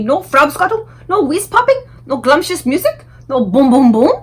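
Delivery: the giant crushed and protesting; dismayed giant. A narrator's reading.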